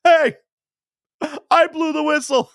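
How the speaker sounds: high, loud flustered ref protesting; helpless referee. Retold or imitated. Referee pleading over a fight in a loud high voice. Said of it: "his mouth going like".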